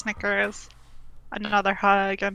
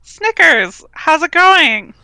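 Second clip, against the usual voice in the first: loud, high, drawn-out hello; slow, gushing welcome; excited greeting; 7 semitones higher, 10 dB louder, slower than usual. Acted. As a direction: greet a sister with big enthusiasm. Greeting sister at the door.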